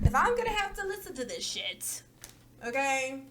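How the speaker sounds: fed-up whine; exasperated. Real, not acted. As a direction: throw up your hands verbally. Exasperated repeat.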